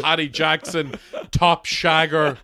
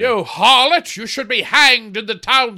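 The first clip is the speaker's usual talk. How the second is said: loud scandalised accusing cry; outraged Puritan; 4 semitones higher, 6 dB louder than usual. Acted. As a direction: denounce her like a village elder. Acting an outraged Puritan townsman.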